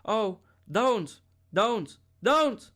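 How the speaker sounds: high, loud pleading scream rising on each don't; terrified scream. A narrator's reading. Linda screaming at the women beating her, terrified. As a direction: beg in panic as the blows fall. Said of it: "Linda screamed".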